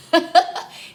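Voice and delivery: high bursting laugh; real laugh. Real, not acted. Mom laughing at her daughter's outfit, high laugh.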